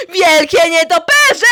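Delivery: sudden loud dramatic reveal; booming announcement. Acted. Host shouting to campers about giant bats. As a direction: boom it out to scare the campers.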